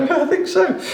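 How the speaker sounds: squeaky high coy "I think so"; shrugging off a cheeky word, coy and high-pitched; coy squeak. Real, not acted. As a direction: play it innocent in a high squeak. Coy reply about cheeky cake word.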